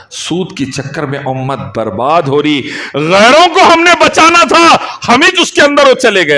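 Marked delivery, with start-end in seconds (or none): 3.0-6.4 impassioned reproach from the pulpit; fiery preaching. Real, not acted. Laments at full force.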